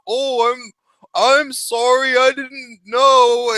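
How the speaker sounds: high, slow imitated apology; drawn-out apology. Woman apologising to the man she hit, put-on drawn-out apology. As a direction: stretch out the sorry in a put-on voice.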